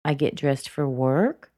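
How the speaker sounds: unfinished upturn on "work"; rising intonation. Shown as a demonstration. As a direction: lift "work" as if continuing a list. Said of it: "She's continuing on".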